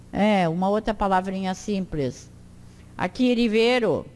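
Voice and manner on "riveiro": RIVEIRO with a light tapped first R; weak R. Shown as a demonstration. Teacher reads the Esperanto word, tapped R in RIVEIRO. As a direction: tap the first R of RIVEIRO once. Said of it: "É sempre fraco".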